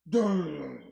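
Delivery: loud punchy sign-off call; loud show-ending shout. Shown as a demonstration. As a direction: hit it loud so the meter pegs. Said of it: "it's gonna peg when we be like".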